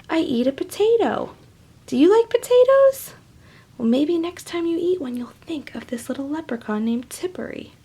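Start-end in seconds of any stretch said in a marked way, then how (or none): 1.9-3.1 eager question. Real, not acted. Host asking children about potatoes, high and eager. 5.1-7.7 soft secret whisper. Real, not acted. Host whispering to children about Tippery. Closing to kids.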